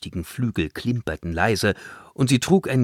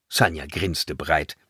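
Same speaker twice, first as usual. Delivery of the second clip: ordinary delivery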